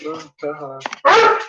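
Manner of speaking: playing the dog's cheerful hello; voicing the dog. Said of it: "She's like".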